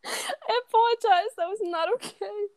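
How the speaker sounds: high voice breaking with laughter; laughing apology. Real, not acted. Apologising to co-host after a slip, helplessly laughing. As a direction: try to apologise while cracking up. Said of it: "I'm laughing".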